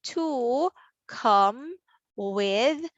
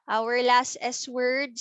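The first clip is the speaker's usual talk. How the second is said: usual speaking voice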